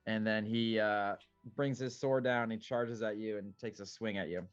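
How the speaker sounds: normal talking voice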